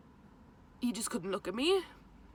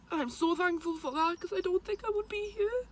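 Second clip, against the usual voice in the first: moved; 8 semitones higher than usual. Real, not acted. Emotional thanks about surviving.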